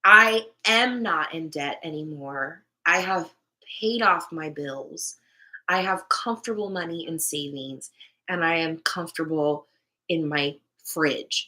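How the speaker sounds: slow, deliberate declaration; measured, assured affirmation; positive affirmation. Shown as a demonstration. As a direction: state it firmly, word by word.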